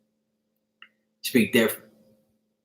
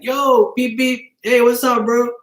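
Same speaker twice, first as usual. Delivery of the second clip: high-pitched acted street hail; calling out; 10 semitones higher, slower than usual. Driver beeping at someone he knows, calling out. Acting out greeting from a car.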